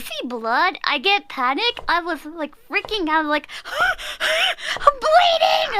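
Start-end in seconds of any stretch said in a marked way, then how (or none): 3.5-4.2 sudden panicked gasp; sharp gasp. Retold or imitated. Cut finger, imitating her own panic: sharp gasp. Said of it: "like that". 4.2-5.8 high, slow, crying "I'm bleeding"; panicked cry. Retold or imitated. Recalling cutting her finger, imitating herself: panicked cry. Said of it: "like that".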